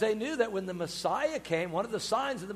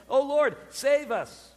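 slow, higher, louder plea; raised plea; 5 semitones higher, 6 dB louder, slower than usual. Retold or imitated. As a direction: declaim it as a prayer for rescue. Preacher declaims the psalm plea.

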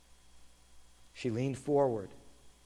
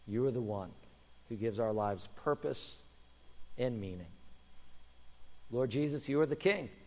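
closing prayer; 8 semitones lower, 9 dB quieter than usual. Acted. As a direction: pray quietly for the congregation.

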